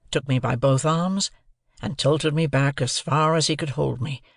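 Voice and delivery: plain reading voice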